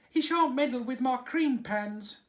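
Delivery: high, loud indignant vow; shrill maid swearing it; vowing dairymaid. A narrator's reading. Dairymaid vowing to the nurse, high and loud. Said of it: "vowed the dairymaid".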